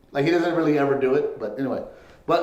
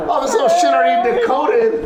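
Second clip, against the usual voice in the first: high, loud laughing outburst; cracking up in mock shock; laughing disbelief; 10 semitones higher, 7 dB louder than usual. Parents' shocked reaction, laughing.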